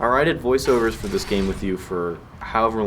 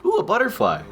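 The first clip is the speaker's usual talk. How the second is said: mock wonder; 13 semitones higher than usual. Mock distraction mid conversation.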